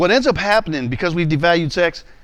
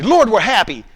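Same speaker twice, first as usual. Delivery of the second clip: higher, louder insistence; insist, pitched up and louder; defensive protest; 5 semitones higher, 7 dB louder than usual. Retold or imitated. A man brushing off God, higher and louder. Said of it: "Now watch my responses".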